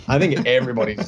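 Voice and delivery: usual speaking voice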